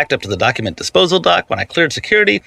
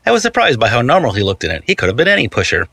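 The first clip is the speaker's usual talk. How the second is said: usual speaking voice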